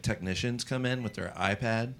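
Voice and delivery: ordinary delivery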